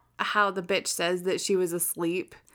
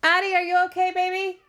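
loud worried call, higher; worried parent; 10 semitones higher, 8 dB louder, faster than usual. Real, not acted. Child got hurt, calling out.

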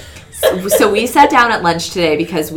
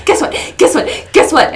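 excited guess what; 8 semitones higher, 3 dB louder than usual. Retold or imitated.

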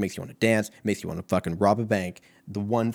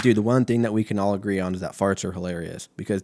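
ordinary talk, nothing marked

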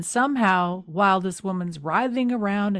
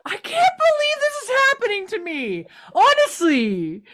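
hammed-up outrage; 7 semitones higher, 4 dB louder than usual. Mock-outraged acting as Cam.

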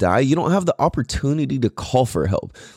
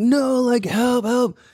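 pleading; 11 semitones higher, slower than usual. Retold or imitated. Pleading for help.

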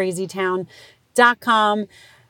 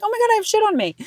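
shrieking oh-my-God at the mess; mock disgust, pitched way up; disgusted shock; 14 semitones higher, faster than usual. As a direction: squeal it out high in horror. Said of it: "And you're like".

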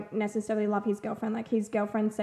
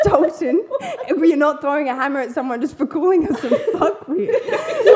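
louder, higher laughing reprimand; mock scolding; 4 semitones higher, 6 dB louder than usual. Sister stops brother throwing a hammer, mock scolding. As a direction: tell him off through laughter.

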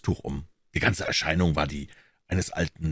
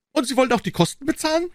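put-on indignant old man; faster than usual. A narrator's reading. Old man confronting Holmes over the papers, high and indignant. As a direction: demand it high and sharp.

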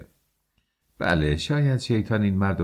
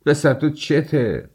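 slow, higher, louder outburst; shouting; 7 semitones higher, 4 dB louder, slower than usual. A narrator's reading. Razumikhin shouting at Raskolnikov in alarm, slow loud cry. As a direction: bellow it out at his friend. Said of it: "رازومیخین فریاد زد".

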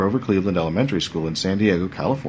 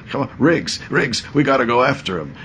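slightly nuts action hero, pitched up; early Mel Gibson; 6 semitones higher than usual. Retold or imitated. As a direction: urge your partner on, a trace of Australian. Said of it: "early Mel Gibson kind of thing".

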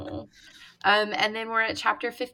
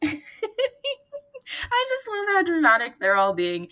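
laughing, slowed; 10 semitones higher, much slower than usual. Real, not acted. Laughs while talking.

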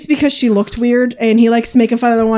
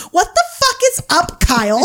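quoting a dad's Vine line; 7 semitones higher than usual. Retold or imitated. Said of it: "and he goes".

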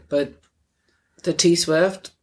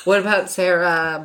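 ordinary talk, nothing marked

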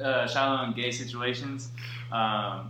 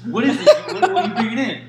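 mock guard yell; 7 semitones higher, 8 dB louder than usual. Guard voiced in retold video.

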